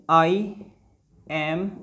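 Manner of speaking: ordinary talk, nothing marked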